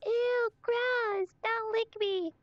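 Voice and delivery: very high, disgusted character voice; squeaky grossed-out voice. Acted. Character dodging a lick.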